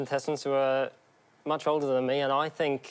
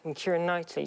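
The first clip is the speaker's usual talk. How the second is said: ordinary talk, nothing marked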